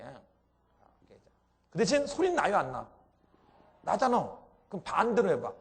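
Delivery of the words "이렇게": breathy voiceless whisper; whispered demo. Shown as a demonstration.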